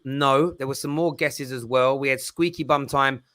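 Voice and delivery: ordinary talk, nothing marked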